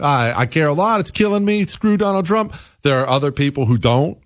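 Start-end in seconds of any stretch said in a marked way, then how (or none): 0.0-2.6 lightly acted, rising-pitched griping; mock complaint. Host voices voters hurt by gas prices, mock griping. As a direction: climb in pitch as a mildly exasperated voter.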